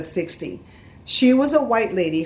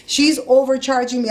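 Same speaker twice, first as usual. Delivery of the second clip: ordinary delivery, nothing marked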